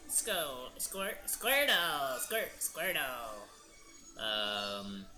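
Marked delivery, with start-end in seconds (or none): 0.1-3.5 slow, drawn-out cartoon creature call; Squirtle. Streamer showing off Pokemon anime voices, drawn-out creature call. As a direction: stretch the name out like a little monster. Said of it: "anime voices".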